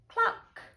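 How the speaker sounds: short, high cluck; hen's cluck. A narrator's reading. Hen answering the ladybird, high cluck. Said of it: "the hen said".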